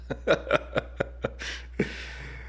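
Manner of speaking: a soft unvoiced laugh at the governor; amused breathy laugh. Real, not acted. Laughing at the governor's muffled microphone, soft breathy laugh. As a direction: breathe out a quiet chuckle.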